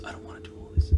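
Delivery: reluctant whisper; whispered grumble to yourself; whispered whine. Real, not acted. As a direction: breathe it out, no voice. Whining aside to self.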